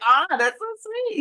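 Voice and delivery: high, slow, delighted gush; touched and happy. Retold or imitated. Retelling boyfriend bringing lunch, high delighted gush. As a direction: melt at a sweet surprise. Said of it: "And I was so happy".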